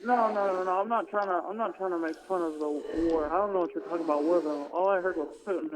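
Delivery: ordinary talk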